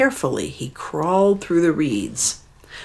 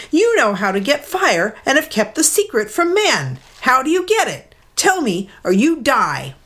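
mild shout; 9 semitones higher than usual. Acted. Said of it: "he shouted".

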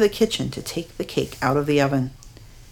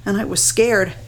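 ordinary delivery

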